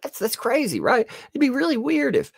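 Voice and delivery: high, faux-puzzled sarcasm; mock innocent. Vlogger mocking the deactivated account, high faux innocence. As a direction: feign innocent surprise.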